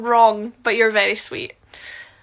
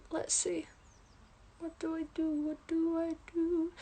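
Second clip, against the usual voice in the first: very soft, higher, absent-minded murmur; murmuring to oneself; 5 semitones higher, 12 dB quieter than usual. Real, not acted. Talks to herself while doing makeup.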